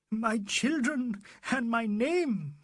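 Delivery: high, slow, drawn-out lament; gasping anguish. A narrator's reading. Arrested young man to Fielding, wings broken, gasping anguish. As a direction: stretch it out high in despair. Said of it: "he gasped".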